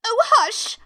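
high, urgent stage whisper, voiced; frightened hushing. A narrator's reading. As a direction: hush them in alarm. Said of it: "whispered in a frightened tone".

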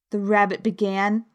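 usual speaking voice

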